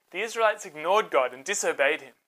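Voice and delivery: usual speaking voice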